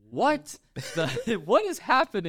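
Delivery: high-pitched comic disbelief; incredulous squeal with a laugh; baffled outburst. Acted. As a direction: burst out, utterly lost. Acts out bafflement at the scene.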